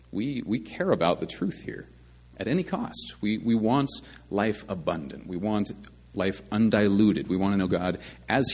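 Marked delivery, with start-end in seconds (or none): none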